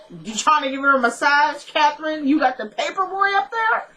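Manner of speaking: high, teasing disbelief; mock incredulous. Viewer mock-questioning Catherine about the paper boy, incredulous.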